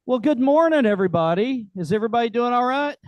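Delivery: rally the room with a big good morning; loud hearty greeting. Real, not acted. Host warmly hollering good morning to the crowd.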